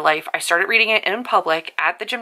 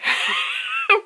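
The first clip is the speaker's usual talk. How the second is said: high squeaky laugh. Real, not acted. Vlogger retelling a funny smut scene, high squeaky laugh. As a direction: break into a high giggle.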